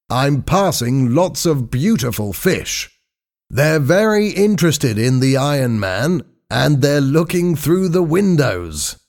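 high-pitched, delighted report; contented diver doctor. A narrator's reading. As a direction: report the sights from below in a high voice. Voices Doctor Blake.

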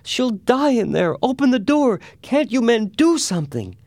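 loud, very high hysterical begging; screaming mother. A narrator's reading. Mother begs the men to free her child, screaming. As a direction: shriek at them in sheer panic. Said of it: "screamed her mother".

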